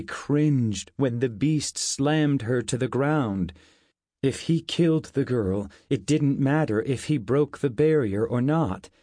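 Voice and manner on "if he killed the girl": plain reading voice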